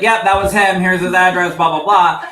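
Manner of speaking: loud, high, breezy blurt; careless blurting. Pretend. Medium imagining blabbing the answer to a client, loud mock blurt. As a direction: rattle it off loudly, mock-eager.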